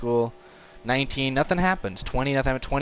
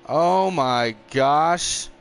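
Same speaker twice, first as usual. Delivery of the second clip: slow, drawn-out oh my gosh; stunned disbelief; 4 semitones higher, 4 dB louder, much slower than usual. Real, not acted. Gamer watching a reckless opponent, slow disbelief. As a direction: let it stretch in disbelief. Reacts to rough driving.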